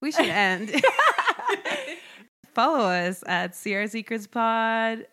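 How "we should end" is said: high laughter around the words; real laughter. Real, not acted. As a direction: laugh as you wrap up. Laughing at the end.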